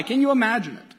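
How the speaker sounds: usual speaking voice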